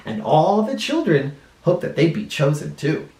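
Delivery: put-on lively storybook voice for kids; reading to children. A narrator's reading. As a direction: read it like a children's librarian.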